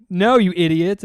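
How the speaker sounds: loud jeering put-down; scornful kid. Retold or imitated. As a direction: shout down the wrong answer. Kid mocks the wrong answer.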